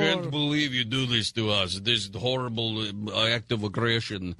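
mock Russian accent, put on lightly; hammed-up Russian official feigning hurt; deep Russian accent. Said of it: "the deepest Russian accent".

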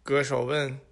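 usual speaking voice

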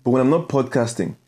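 confident host ad read. Acted. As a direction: sell the agency like a radio spot. Podcast ad read.

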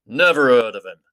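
mildly gruff growl. A narrator's reading. Beorn brushing off the wizard, mild growl. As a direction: grumble it off dismissively. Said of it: "growled the man".

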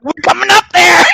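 high, loud, fast challenge; defiant shout. Retold or imitated. Protesters answering Beverly Hills, high defiant shout. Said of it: "we were like".

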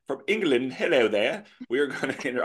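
high, loud put-on English accent; hammed-up English accent, as a teasing bit; mock English accent. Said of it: "say it with an English accent".

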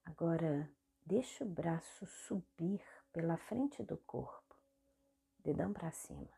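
soft meditation guide with long pauses; guided meditation. Real, not acted. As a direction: guide the body gently through each movement, leaving space between phrases. (meditação guiada, voz suave e pausada)

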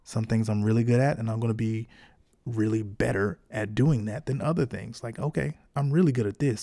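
ordinary talk

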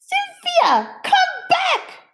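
put-on very high child call; demanding child. A narrator's reading. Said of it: "Emma demanded".

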